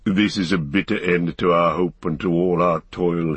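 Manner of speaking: understated grim dwarf's bitterness; mildly embittered character voice; Gimli, teeth ground. A narrator's reading. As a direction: speak as if through gritted teeth. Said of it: "Gimli ground his teeth".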